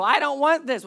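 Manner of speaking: high, loud mock kid's refusal; complaining child. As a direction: protest like a kid refusing dinner. Child complains at the dinner table.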